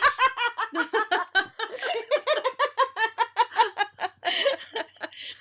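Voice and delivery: high, helpless cackling laugh; high long laugh. Real, not acted. Long laugh after quote.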